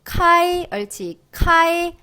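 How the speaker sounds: 카이 pitched high and firm, 아 carrying the stress; pinyin kai demo. Shown as a demonstration. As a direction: sing out 카이 cleanly like a model answer. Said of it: "아이, 시작".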